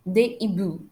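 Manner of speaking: Des hiboux said "day ee-boo"; silent S and X. Shown as a demonstration. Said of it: "I don't pronounce the letter S".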